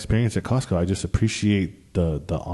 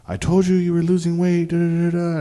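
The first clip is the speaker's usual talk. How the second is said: much higher, told-you-so voice; imitating his girlfriend; 9 semitones higher than usual. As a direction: play her smug I-told-you-so. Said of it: "She's like".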